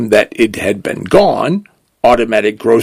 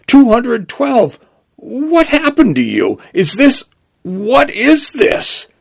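bewildered; 13 semitones higher than usual. Acted. Vehicle shocked at changed store.

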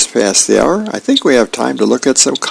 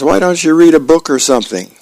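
higher, quicker voice for the wife; brisk wifely suggestion; comic-strip wife; 6 semitones higher, faster than usual. Acted. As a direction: suggest it as a wife prodding her husband.

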